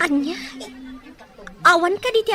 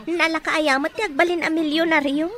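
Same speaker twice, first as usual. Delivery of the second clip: usual speaking voice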